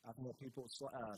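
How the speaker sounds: ordinary talk, nothing marked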